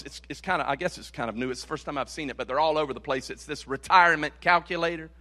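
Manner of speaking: ordinary delivery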